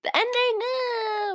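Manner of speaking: high mock sob; mock crying. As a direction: pretend to cry over it. Teases the sad ending.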